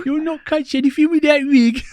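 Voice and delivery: very high, hammed-up Brummie accent; a squeaky over-the-top Brummie voice. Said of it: "attempt to do a Brummie accent".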